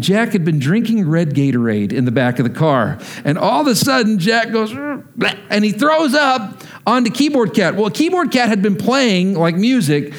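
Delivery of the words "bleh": quick mock vomit "bleh"; retching noise. Father imitating his son throwing up, mock retch. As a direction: imitate a short throw-up sound. Child throws up in retold story.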